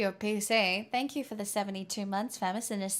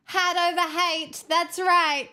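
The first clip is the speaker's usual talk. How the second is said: excited cheer; 8 semitones higher, 5 dB louder, slower than usual. Real, not acted. Cheering along with the clip.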